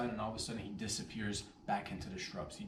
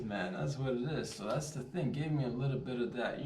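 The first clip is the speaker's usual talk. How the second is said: usual speaking voice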